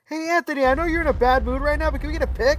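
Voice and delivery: high-pitched, tentative fan voice; timid fan request. Acted. Fan asking moody Anthony for a pic, high and careful.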